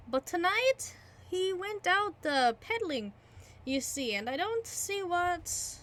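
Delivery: high, amused innkeeper. Acted. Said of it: "the landlord chuckled".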